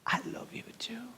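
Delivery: slow unvoiced whisper, moved to tears; tearful whisper. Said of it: "me puse a llorar". (susurro entre lágrimas)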